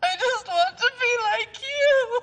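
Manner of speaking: desperate crying plea. Acted. Pleading with Shayda for her baby, crying. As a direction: beg through sobs for the baby.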